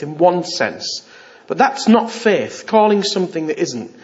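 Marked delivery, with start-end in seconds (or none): none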